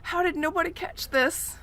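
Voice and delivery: high, disbelieving question; incredulous. Retold or imitated. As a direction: player baffled by a dropped accent, incredulous. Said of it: "I'm like".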